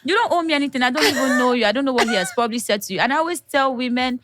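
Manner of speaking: usual speaking voice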